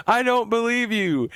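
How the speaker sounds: high, slow ghostly denial; a hammed-up spectre refusing; ghost mom. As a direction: ghost mother doubting Shannon, high and slow. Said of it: "And then the ghost mom goes".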